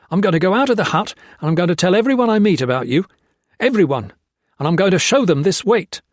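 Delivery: fast, high, loud menace; threatening. A narrator's reading. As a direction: threaten him, building. Gray threatens Blakely.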